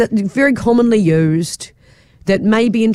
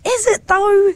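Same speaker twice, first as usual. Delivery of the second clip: surprised; slower than usual. Retold or imitated. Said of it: "And I was like".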